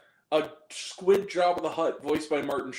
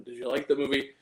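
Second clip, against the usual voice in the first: normal talking voice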